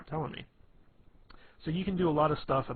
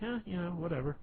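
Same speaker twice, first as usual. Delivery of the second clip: lazy, mock-dismissive shrug, a bit higher; lazy, uncaring; 6 semitones higher than usual. Brushing off whatever someone says to you, lazy and uncaring. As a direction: shrug it off as if you couldn't care less. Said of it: "being lazy and not caring".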